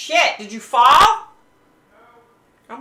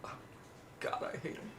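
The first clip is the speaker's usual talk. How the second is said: breathy whisper, fed up; whispered gripe; 4 semitones higher, 17 dB quieter, faster than usual. Real, not acted. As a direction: hiss it under your breath in disgust. Repeats hating socks.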